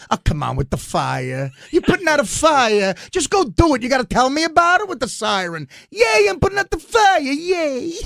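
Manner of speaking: high, loud mocking rant; hammed-up Dice mocking the siren; Dice impression. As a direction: sneer at the fire truck, shouting high. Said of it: "he goes".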